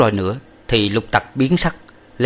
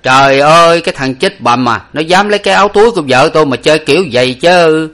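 outraged husband crying out; loud indignant outburst at the rascal; loud exclamation; 7 semitones higher, 7 dB louder than usual. A narrator's reading. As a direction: exclaim loudly, rising higher as it goes. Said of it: "nói lớn lên rằng".

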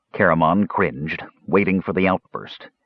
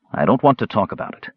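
usual speaking voice

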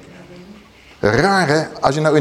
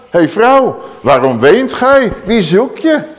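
higher, louder, slow and tender question; slow, raised voice of Jesus; Yeshua speaking; 5 semitones higher, 3 dB louder, slower than usual. Acted. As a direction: call to her with compassion.

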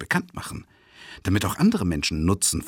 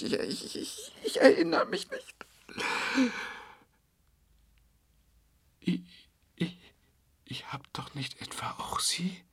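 terrified stammering whisper; 4 dB quieter than usual. Acted. Stammering in horror.

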